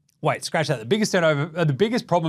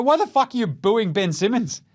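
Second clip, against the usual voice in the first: ordinary delivery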